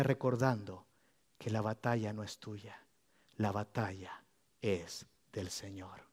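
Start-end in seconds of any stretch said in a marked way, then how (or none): 1.4-6.0 softer, slowing reminder; hushed sermon reminder; soft emphasis. Real, not acted. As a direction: let it land gently. Preacher repeats key line softly.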